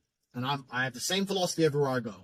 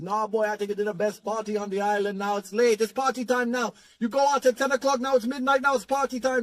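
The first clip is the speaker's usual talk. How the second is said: put-on higher, lilting Jamaican accent, hyping it up; 5 semitones higher than usual. Grim insisting it's party time, in a raised Jamaican accent. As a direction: sell the late-night party like an excited Jamaican mate. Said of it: "He goes".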